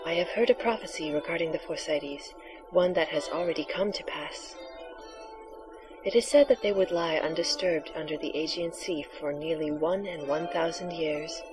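hushed mystical seer; breathy oracle voice. Acted. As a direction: softly intone the prophecy. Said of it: "your breathy, sexy voice for the oracle".

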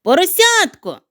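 high loud call. Acted. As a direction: call across the snowy yard. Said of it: "гукнув він".

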